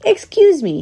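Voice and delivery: high-pitched call to a passer-by; high, polite call. A narrator's reading. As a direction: hail a stranger in a high old squirrel voice. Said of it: "he called out".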